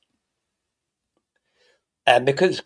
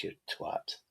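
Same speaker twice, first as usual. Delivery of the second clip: whispered insult; 19 dB quieter than usual. Real, not acted. Muttered insult to friend.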